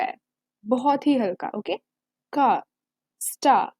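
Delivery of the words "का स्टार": का and स्टार with no r, "kaa, staa"; स्टार said "staa", r cut; r dropped. Shown as a demonstration. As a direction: cut the r completely. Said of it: "आप र completely cut भी कर सकते हो".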